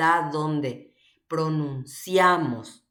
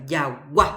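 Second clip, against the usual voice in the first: Jagua with a loud final A; stress on final A; 3 semitones lower, 4 dB louder than usual. Shown as a demonstration. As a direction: push the last A of "Jagua" hard. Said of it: "Ja'e A ári jajepa". ("Jagua" con la A final más fuerte)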